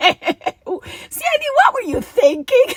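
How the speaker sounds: amused, loud exasperation at yourself; laughing self-scolding. Real, not acted.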